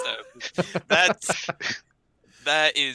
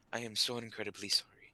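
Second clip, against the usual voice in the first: hushed, sheepish apology; apologetic; 3 semitones lower, 9 dB quieter, faster than usual. Acted. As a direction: say sorry softly and sheepishly. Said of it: "most apologetic look I can".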